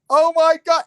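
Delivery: high, loud burst of joy; thrilled cry of relief; excited. Retold or imitated. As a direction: squeal at mom finally on screen. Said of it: "I was like".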